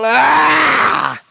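loud high shout; hammed-up requested scream. Shown as a demonstration. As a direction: belt out a scream for the viewer. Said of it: "[name] teriak lagi".

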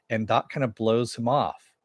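ordinary delivery

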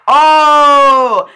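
long, loud, high "oh"; excited cry. Real, not acted. Fan reacting to a big play, excited cry.

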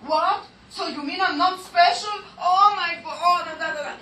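high-pitched indignant outburst, acted out; upset child's protest. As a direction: act an offended child, pitch jumping up. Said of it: "in the fourth, uh, piece, it goes".

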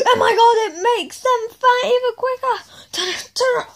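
high, frantic alarm; hammed-up high-pitched fluster; acted panic. Acted panic at fattening machine.

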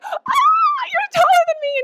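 shrieking with joy at meeting him; crazy excited. Retold or imitated. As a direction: squeal it like you're jumping up and down. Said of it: "I get crazy excited about stuff".

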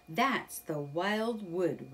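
slow, careful and wary; cautiously. Acted. Rat warns Mole about the Wild Wood, slow and cautious. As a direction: say it slowly, wary of the place. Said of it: "Rat said cautiously".

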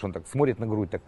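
normal talking voice

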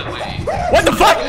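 high, slow stunned squeal then laugh; drawn-out shocked squeal. Real, not acted.

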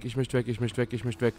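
ordinary delivery

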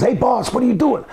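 startled, pleading to be let go; alarmed protest. Retold or imitated. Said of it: "He says".

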